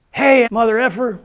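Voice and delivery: loud, high-pitched call-out; imitated yell. Officer hailing the inmate in the hospital, imitated yell. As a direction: holler it like an officer rousing someone. Officer yelling at him in retelling.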